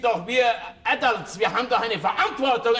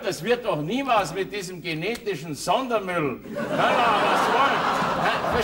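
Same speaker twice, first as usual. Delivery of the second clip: normal talking voice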